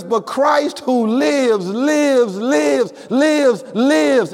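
each lives hit high and falling; preacher pounding home that Christ lives; emphatic repetition. Shown as a demonstration. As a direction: hammer lives again and again. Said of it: "It's in the emphatic".

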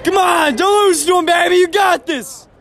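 cheering shout. Real, not acted. Cheering teammate from dugout.

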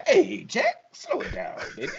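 high, put-on jive voice; jive talk impression. Quoting Airplane's jive scene in a hammed-up voice. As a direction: ham up the Airplane jive lady. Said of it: "Missus Beaver talking jive".

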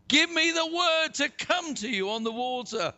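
high, projected plea; calling out. A narrator's reading. Peter asking Jesus to let him come, calling out. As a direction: call your request across the water.

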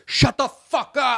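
high, strained, drawn-out imitation of an angry passenger; mimicked yell from across the aisle; mock yell. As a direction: screech it like a fed-up stranger, not at full volume. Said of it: "hear some person just yell".

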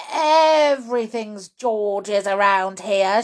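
loud, exasperated complaint; shouting grandma. Acted. Grandma cuts her daughter off, shouting crossly. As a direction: shout over the interruption. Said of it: "shouted Grandma".

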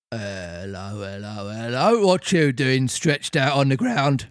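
police officer finding a puppet on the ground, bobby voice; Victorian bobby. Acted. Carabinier voice.

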